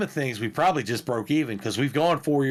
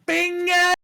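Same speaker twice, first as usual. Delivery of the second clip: high, loud yell of the word; yelled bingo; 5 dB louder than usual. Retold or imitated. Man in a bingo hall of women, yelling. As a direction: shout it out across the hall. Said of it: "Boy, I yelled".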